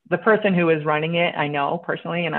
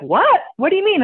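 mock-shocked, pitched high, a little louder; 11 semitones higher, 4 dB louder than usual. Imitating the investor's shocked reply after she stands up, high and startled. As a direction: imitate the man caught off guard, voice jumping up. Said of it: "and now he's shocked".

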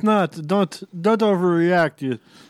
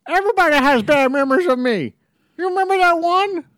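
high, loud cartoon fridge; shrill appliance voice; 12 semitones higher, 3 dB louder than usual. Fridge answering the crowd, high and loud. Missus Refrigerator voice.

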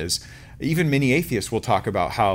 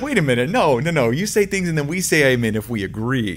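higher, louder objection as a puzzled crowd; mock protest; 4 semitones higher, 5 dB louder than usual. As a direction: cut in with hammed-up disbelief. Acted crowd objecting to Jesus.

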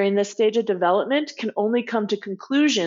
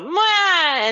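higher mock whine of a cranky kid; whiny pout cry; 9 semitones higher, 3 dB louder than usual. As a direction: imitate a pouting child's whimper. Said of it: "and whine".

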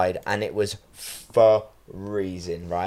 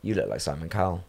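lower, softer, expressionless quote; imitated blunt deadpan; 4 semitones lower, 7 dB quieter than usual. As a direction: deliver it like a kid stating a plain fact. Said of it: "just deadpan".

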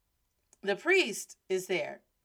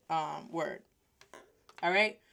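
plain reading voice

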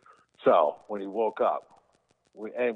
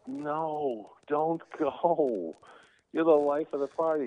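high, pleading Jim Carrey voice; much higher mock plea, Carrey style; Jim Carrey impression; 10 semitones higher, slower than usual. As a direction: beg them to stay like Jim Carrey. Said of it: "Jim Carrey's like".

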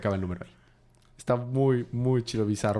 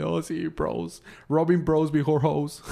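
slow, high goofy bro voice; dumb bro voice; 5 semitones higher, slower than usual. Retold or imitated. Jason quoted, emotive moment parody.

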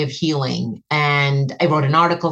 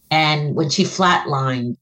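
ordinary delivery

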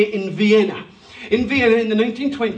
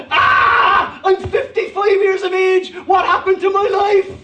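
a high, loud mock cry of dismay; mock panic; 8 semitones higher, 3 dB louder, slower than usual. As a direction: shout it as if you suddenly realise you're old. Imagined panicked self at fifty-five.

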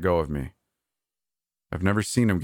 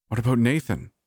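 stammered; 6 semitones higher than usual. A narrator's reading. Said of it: "I finally stammered".

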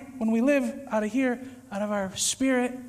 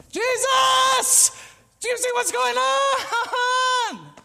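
high, loud desperate cry to Jesus; panicked shout; 13 semitones higher, 5 dB louder, much slower than usual. Retold or imitated. Panicked believer shouting for Jesus, loud and high. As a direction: call out frantically for Jesus, slow and stretched.

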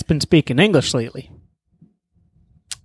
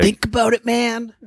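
stoner voice; 8 semitones higher than usual. Retold or imitated. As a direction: play a baked stoner pondering. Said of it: "he got all Jon Stewart half-baked on me".

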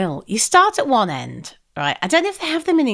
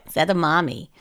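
American server; 7 semitones lower, 3 dB quieter than usual. Retold or imitated. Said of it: "so she's like".

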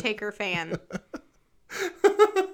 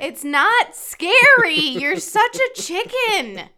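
teasing; 7 semitones higher, 6 dB louder, slower than usual. Real, not acted. Teasing co-host to watch show.

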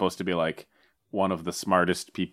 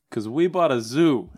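slow, hammed-up Boston accent, a bit higher; mock Boston accent; 5 semitones higher, slower than usual. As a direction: drawl the joke out in a Boston accent. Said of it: "harassed with these Boston accents".

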